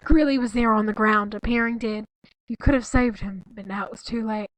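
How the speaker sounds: reading the ending of the fic aloud; story reading. Acted. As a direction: read the last lines of the story deadpan. Reads the fanfic aloud.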